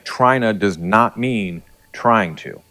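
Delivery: very slow, each word weighted; "Trina does not mean trying to" spelled out slowly; slow and deliberate. Shown as a demonstration. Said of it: "I'm gonna say that again".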